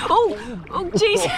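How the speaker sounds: sudden shocked yelp; startled. Real, not acted. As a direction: caught off guard by a drop. Pool dips suddenly.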